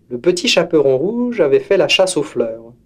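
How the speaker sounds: usual speaking voice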